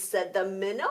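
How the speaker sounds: usual speaking voice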